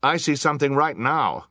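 high, loud alert; crying out. A narrator's reading. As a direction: cry out a sighting to the crowd. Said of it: "cried a voice".

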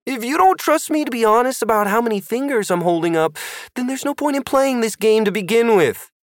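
high, lightly voiced boy; character voice. Sid voiced in story.

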